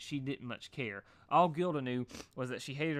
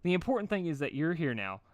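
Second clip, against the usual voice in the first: higher bubbly put-on cheer; Pinkie Pie impression; 6 semitones higher, 4 dB louder than usual. A narrator's reading. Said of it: "doing her best Pinkie Pie impersonation".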